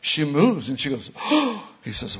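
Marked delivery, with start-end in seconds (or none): 1.2-1.7 sharp gasp into a high "oh"; startled gasp. Retold or imitated. Healed woman moving her leg, startled gasp. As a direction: imitate a sudden gasp of astonishment. Retold woman finds pain gone.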